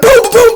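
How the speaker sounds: high loud boom sound; mock gunshot booms. Hiker imitating gunshots, loud booms. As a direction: shoot off imagined booms.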